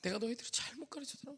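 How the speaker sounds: quiet tearful repeat. Retold or imitated. Teacher repeating regret to students, quiet tearful lament. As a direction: repeat it broken and hushed. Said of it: "막 우시는 거예요".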